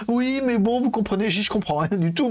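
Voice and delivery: high, sing-song feigned confusion; mock confused rep. Parodying the brand rep who claims not to understand, high and mocking. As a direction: play dumb in a high put-on voice.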